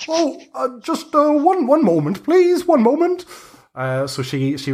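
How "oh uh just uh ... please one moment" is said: put-on high squeaky old hag calling down from the trees; high-pitched busy hag. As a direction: play a flustered old crone, pitched up high. Hag voice again.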